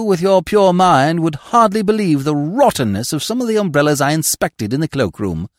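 a hint of lofty connoisseur; unruffled gentleman. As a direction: explain the theft as a favour. Smith explains blandly, loftily.

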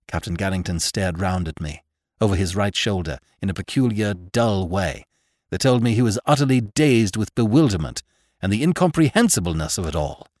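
ordinary delivery, nothing marked

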